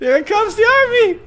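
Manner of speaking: high, laughing hype call; laughing excited. Real, not acted. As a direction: cackle it out, thrilled. Repeats it laughing, much higher.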